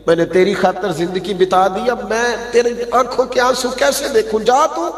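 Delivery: mother lets her son go to Madina, building emotion; mother letting go. Acted. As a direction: release your son with breaking devotion. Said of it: "کہنے لگی".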